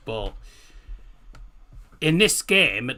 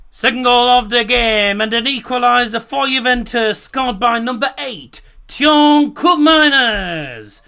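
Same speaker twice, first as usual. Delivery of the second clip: raised, formal play-by-play read; commentator announcement; 7 semitones higher, 7 dB louder than usual. Acted. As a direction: announce the score like a broadcaster.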